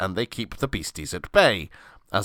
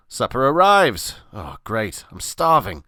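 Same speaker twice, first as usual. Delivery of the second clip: ordinary delivery, nothing marked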